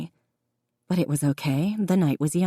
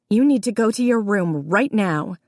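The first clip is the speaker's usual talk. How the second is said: stern order; 4 semitones higher than usual. A narrator's reading. Jessica ordering her brother to his room, stern. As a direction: lay down the law.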